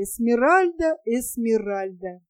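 usual speaking voice

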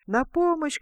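high cry for help. A narrator's reading. King blown about calling for help, high cry. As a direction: call out in panic. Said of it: "кричит король".

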